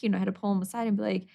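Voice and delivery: ordinary talk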